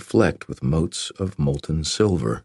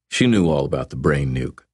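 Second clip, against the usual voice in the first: plain reading voice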